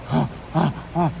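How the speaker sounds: imitated short, stifled puffs of breath; mock held-back gasps. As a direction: act out a timid, choked-back speaker. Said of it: "who's telling you to whisper".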